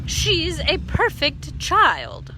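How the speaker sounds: loud, slow, high haughty voice; a smug neighbour lecturing; slow loud pompous; haughty insistence. A narrator's reading. Missus Shin insisting.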